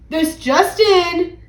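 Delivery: slow, high, drawn-out announcer; stretched-out broadcast opener; radio bulletin. A narrator's reading. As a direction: announce breaking news into a microphone. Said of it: "said the mayor into the microphone".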